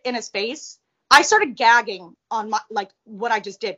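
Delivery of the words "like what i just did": ordinary talk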